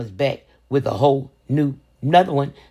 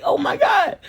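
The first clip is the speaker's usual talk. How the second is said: shocked. Retold or imitated. Mother hearing her toddler repeat a bad word, high horrified. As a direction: squeal it out in horror.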